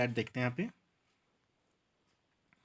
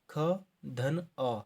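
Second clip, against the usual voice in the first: ख धन अ said very slowly, each part apart; slow teaching demo; much slower than usual. Shown as a demonstration. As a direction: lay out ख plus अ step by step. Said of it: "तो आता है ख".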